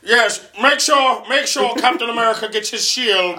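put-on old-timey hero voice. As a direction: speak like a grand Golden Age hero. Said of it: "they all talk like this".

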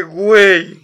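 a loud, high, drawn-out exasperated "güey". Retold or imitated. Recalling the confused customer, a loud exasperated groan of "güey". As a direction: let out pure disbelief at the customer. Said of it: "Y yo así de".

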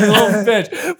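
amused teasing through a laugh. Real, not acted. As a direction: laugh the words out. Laughing echo.

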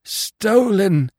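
high, stricken moan. A narrator's reading. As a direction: moan it out in despair. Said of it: "he moaned".

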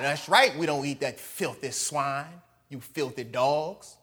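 a hint of smug pride; mock proud sneer. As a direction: sneer down at the outsiders.

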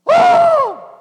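very high, loud startled cry; startled shriek. Retold or imitated. People freaking out at the Spirit, shrieking. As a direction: shriek in alarm.

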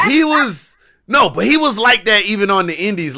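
normal talking voice

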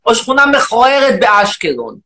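shouting, indignant rant at the audience; loud mock rant. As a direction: bellow it like an outraged demagogue. Loud persona.

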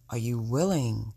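usual speaking voice

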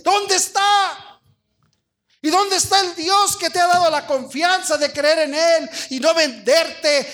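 full-force sermon, stretched cries of challenge; impassioned preaching. Real, not acted. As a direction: fire up the congregation, pitch climbing with each question.

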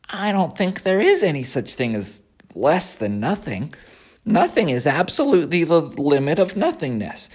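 high, whiny piglet voice; a disappointed young pig. Acted. Wilbur reasons about nothing.

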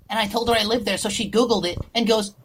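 ordinary talk

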